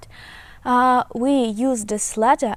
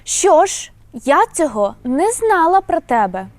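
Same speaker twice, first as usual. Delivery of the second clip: Що ж pitched high and loud, then the sentence stays raised and loud; emphatic surprise; 6 semitones higher, 5 dB louder than usual. Shown as a demonstration. Teacher demonstrating a surprised Що ж sentence, loud and high. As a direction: sound surprised and emphatic, voice up and louder. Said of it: "Let's make the full sentence".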